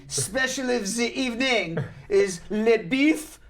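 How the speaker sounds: haughty French waiter, high and drawn out; snooty waiter. Retold or imitated. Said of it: "very snooty waiter".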